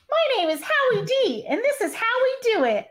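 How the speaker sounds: high-pitched Mickey voice; [name]. Acted. Host reading a boy-band skit in high Mickey voice. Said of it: "I give [name] the high-pitched Mickey voice".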